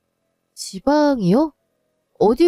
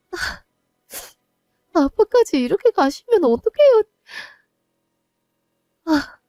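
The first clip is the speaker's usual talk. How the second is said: anguished cry; 9 semitones higher than usual. Acted. Daughter alone after father leaves, crying out in despair. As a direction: call after him in despair.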